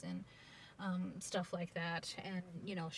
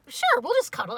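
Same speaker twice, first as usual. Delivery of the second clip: high, louder, laughing through it; laughing naive reply; 14 dB louder than usual. Retold or imitated. Grandfather agreeing to the night, high and laughing. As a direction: say it through giggles, innocently. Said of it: "my grandfather's gone".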